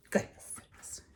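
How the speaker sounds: soft unvoiced whisper; hushed storytime aside. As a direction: breathe it in mock dismay.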